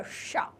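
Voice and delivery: "शा" as ष, tongue near rough palate; retroflex sha. Shown as a demonstration. Teacher explaining tongue position, "शा" with tongue curled back. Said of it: "अंदर मुड़कर थोड़ा सा".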